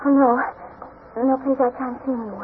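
frightened. Acted. Frightened girl refusing visitors.

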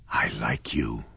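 breathy whisper, very soft; soft whispered confession. A narrator's reading. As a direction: confide it barely aloud. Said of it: "she whispered".

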